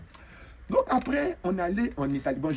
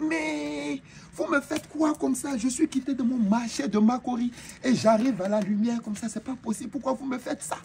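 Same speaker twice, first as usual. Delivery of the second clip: overwhelmed wail; 10 semitones higher than usual. Retold or imitated. Newcomer dazzled by Las Vegas lights, wailing in stunned wonder. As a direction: cry out like a villager dazzled by the city.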